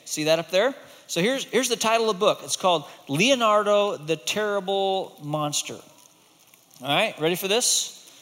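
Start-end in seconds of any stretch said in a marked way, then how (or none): none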